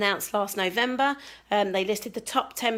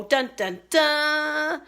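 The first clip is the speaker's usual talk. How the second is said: mock fanfare; 5 semitones higher, much slower than usual. Fanfare before the catalogue.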